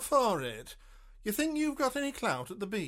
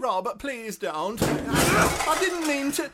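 panicked pleading; 9 semitones higher, 7 dB louder, slower than usual. Acted. Agent panics as Rob threatens.

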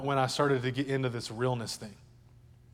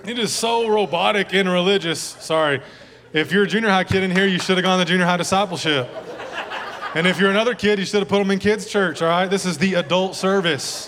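louder, laughing, fast; loud joking preaching; 5 semitones higher, 7 dB louder than usual. Real, not acted. Pastor teasing parents of kids, loud and laughing. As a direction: punch it out to the room with a grin.

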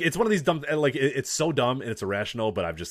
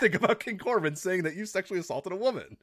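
lightly voiced announcer, pitched up; mock backstage interviewer; 9 semitones higher than usual. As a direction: put on a hint of interview-host polish. Acts out a backstage interviewer's introduction.

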